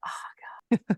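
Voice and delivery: breathless whisper of dismay; whispered thought. Retold or imitated. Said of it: "I thought".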